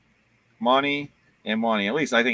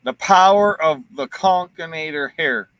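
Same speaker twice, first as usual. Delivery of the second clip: slow, louder, grand announcement; mock epic hype; 3 semitones higher, 7 dB louder, slower than usual. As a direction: hammer it like a movie trailer. Hyping friend's hair.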